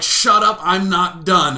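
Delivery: loud retold shutdown from the date; mock angry snap. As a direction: cut him off loudly, imitating her. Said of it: "she'd be like".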